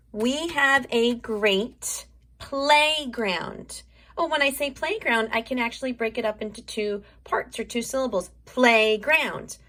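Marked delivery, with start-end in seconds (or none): 0.1-3.8 drawn-out, very slow sentence; sounding out the whole sentence for kids; very slow stretched sentence. Shown as a demonstration. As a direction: stretch every word out slowly. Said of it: "really stretch that out".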